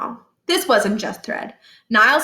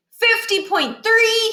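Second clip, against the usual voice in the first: called-out numbers; 13 semitones higher, 3 dB louder, much slower than usual. Acted. Calling out fake measurements.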